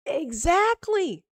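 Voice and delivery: slow, triumphant "told you so"; emphatic drawn-out exactly. Real, not acted. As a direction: draw it out to rub the point in. Drawn-out repeat after unanswered question.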